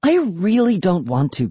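high, whiny big sister; reluctant whine. A narrator's reading. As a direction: beg off in a pouty voice. Pouting sister refuses.

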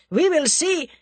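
high, loud angry burst; furious Russian defiance; snarled threat. A narrator's reading. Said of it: "snarled the Russian".

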